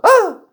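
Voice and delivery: short, high, loud mock shriek; exaggerated startled scream. Imitating girls startled by a prank, exaggerated scream. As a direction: yelp like a startled girl. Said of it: "the girls just went".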